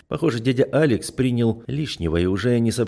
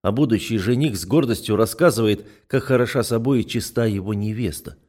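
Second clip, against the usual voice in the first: usual speaking voice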